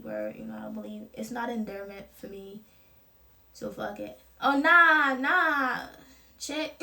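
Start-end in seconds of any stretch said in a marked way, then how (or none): 4.4-6.7 dismissive brush-off. Pretend. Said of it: "be like".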